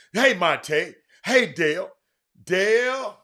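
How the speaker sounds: loud greeting called to viewers; calling out. Real, not acted.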